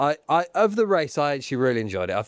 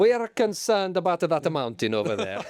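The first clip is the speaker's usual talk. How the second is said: put-on comic Italian accent; mock Italian official; 3 semitones higher than usual. Officials worrying about a mountain, mock Italian accent. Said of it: "They go".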